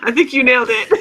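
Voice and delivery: laughing through the words; giggling while talking. Real, not acted. Laughing at friend's vibrating voice.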